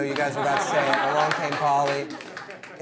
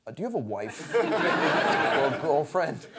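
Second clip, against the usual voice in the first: 1 villain line; 2 semitones lower, slower than usual. Retold or imitated. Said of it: "he was gonna be like".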